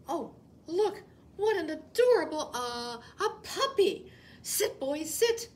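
high, loud gushing; mock puppy delight. Rabbit gushes over a puppy, high and loud. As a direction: coo at a pretend puppy.

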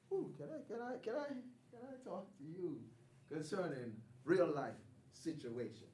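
small, soft, timid asking; a hushed, hesitant request to God; timid plea. Retold or imitated.

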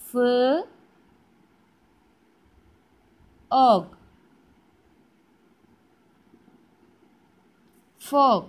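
ഫ്, long pause, loud ഓഗ്, then whole ഫോഗ്; sounding out. Shown as a demonstration. Teacher writing for children, slowly sounding out ഫോഗ്. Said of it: "sound പറഞ്ഞ് പഠിക്കുന്നതാണ്".

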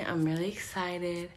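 normal talking voice

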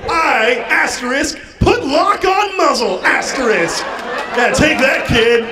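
high, emphatic mock triumph; hammed-up, high-pitched gloating; gloating attack. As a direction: announce the move like a winning blow. Gloating while locking the muzzle.

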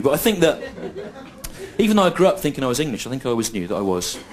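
ordinary delivery

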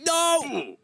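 a sudden high cry of alarm as the goat attacks; startled cry. Real, not acted. Player startled by a charging goat, high alarmed cry. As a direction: yelp as if caught off guard.

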